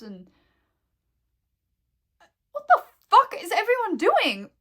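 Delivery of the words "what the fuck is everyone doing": loud, high, crying-out outburst of disbelief; exasperated shout. Real, not acted. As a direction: yell it out, can't believe it. Outraged rant about school violence.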